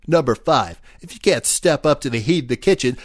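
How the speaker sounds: brash chess hustler ad read; cocky ad voice. Acted. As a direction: sell it like a tough-guy pitchman. Chester ad read in character.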